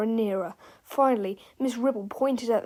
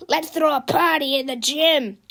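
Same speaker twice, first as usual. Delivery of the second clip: raised, celebrating character voice; shouting teacher; 5 semitones higher, 6 dB louder than usual. A narrator's reading. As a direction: call it out loudly to the crowd. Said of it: "shouted Mister Meena".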